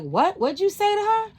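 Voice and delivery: high, loud disbelieving what did you say; indignant customers jumping in; shocked outburst. Retold or imitated. Customers turning on the rude lady in shocked disbelief.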